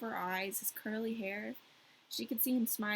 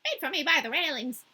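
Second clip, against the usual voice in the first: a lightly voiced chatty crow, higher; 5 semitones higher, 6 dB louder than usual. The crow answering Gerda in a light crow voice. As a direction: croak it out as a talkative crow. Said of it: "difficult to enunciate in this voice".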